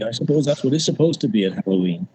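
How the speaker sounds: ordinary talk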